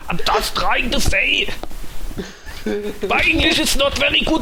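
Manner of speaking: put-on Arnie accent. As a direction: keep hamming up the Arnie voice. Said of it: "my Arnie accent".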